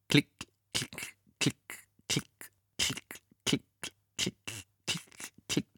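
whispered lock clicks. Acted. Locks clicking imitated.

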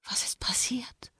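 faint high whisper of the dead; ghostly whisper. Acted. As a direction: breathe it like a ghost. Dead woman's voice quoted.

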